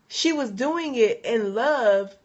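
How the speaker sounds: normal talking voice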